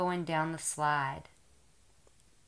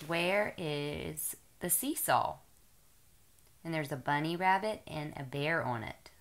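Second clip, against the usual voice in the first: put-on reading to a toddler; 3 semitones higher, 3 dB louder than usual. Mother reading picture book to toddler.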